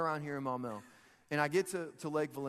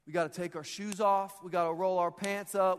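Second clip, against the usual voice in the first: normal talking voice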